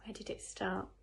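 ordinary delivery, nothing marked

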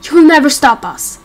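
yelled out loud in fury; full-throated accusation; defiant shout. Acted. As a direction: hurl it at the monster. Defiant outburst at the villain.

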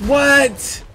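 squeaky, very high disbelief; shocked what. Real, not acted. Gamer shocked by his pet, high squeak. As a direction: yelp in total shock.

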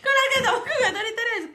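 cracking up, words breaking into laughter; laughing. Real, not acted. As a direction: repeat it but lose it to giggles.

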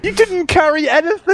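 high-pitched, incredulous objection; indignant protest. Real, not acted. Teammate disputing who carried, indignant. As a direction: shoot the boast down in a high voice.

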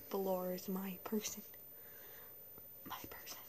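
soft unvoiced whisper. Shown as a demonstration. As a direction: hush it like a secret. Whispered repeat.

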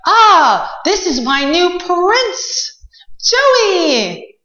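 high, slow, thrilled little princess; excited princess. Acted. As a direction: squeal it out like a delighted princess. Princess introduces her prince.

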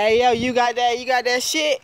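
high, put-on nagging voice; mocking everybody. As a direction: mimic people pestering for the video.

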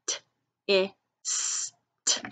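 "t, i, s, t" as isolated phonics sounds; crisp t, short i, held "s", crisp final t; sounding out letters. Shown as a demonstration. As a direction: say each sound of "t, i, s, t" separately. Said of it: "we hear d".

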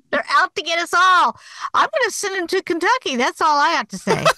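meme voice. Retold or imitated. Quoting a throwback meme.

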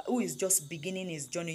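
normal talking voice

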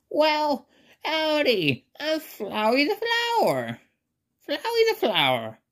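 very high cartoon howdy; Flowey. Talking flower introduces itself to the traveler, high cartoon voice. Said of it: "I'm gonna do my impersonation of Flowey".

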